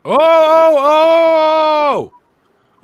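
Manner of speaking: high, loud rising oh of discovery; the penny finally drops; sudden realization. Pretend. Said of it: "Simply say".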